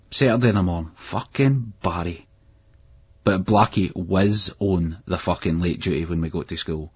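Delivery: ordinary delivery, nothing marked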